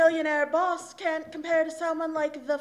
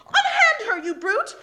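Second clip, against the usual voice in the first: defiant cry; 9 semitones higher, 8 dB louder than usual. Acted. Heroine defying the brute.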